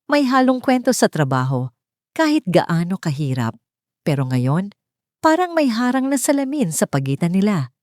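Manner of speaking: usual speaking voice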